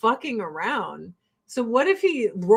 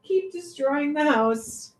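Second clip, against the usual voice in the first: scolding the cat; 6 semitones higher than usual. Real, not acted. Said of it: "I gotta yell at this cat".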